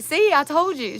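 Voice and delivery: high, loud, excited retelling of a friend; loud, high mock told-you-so. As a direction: burst out like she saw it coming.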